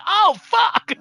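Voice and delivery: high, drawn-out mock dismay; dismayed realisation. Player realising she left partner stranded, hammed-up dismay. Retold dismay at own mistake.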